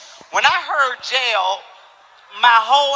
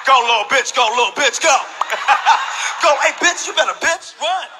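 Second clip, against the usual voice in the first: hammed-up chase with a yell and cackles; SpongeBob chasing kids; slower than usual. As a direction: play the costume guy chasing the kids, hollering. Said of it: "This is how SpongeBob was running".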